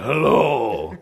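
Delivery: be the huge relative rumbling a greeting; giant's deep hello. Retold or imitated. Giant family member answering in a low hello. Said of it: "They're like".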